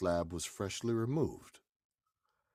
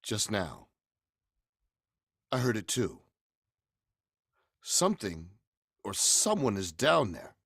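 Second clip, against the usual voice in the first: louder, commanding and pressing; urgent loud command; 4 semitones higher, 8 dB louder than usual. Acted. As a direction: order them down to the cellar. Urging them down to the cellar.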